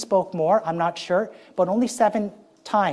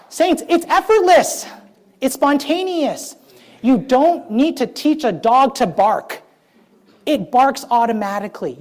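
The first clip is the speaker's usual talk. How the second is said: fervent preaching; 5 semitones higher than usual. Acted. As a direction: stretch each word out to the congregation.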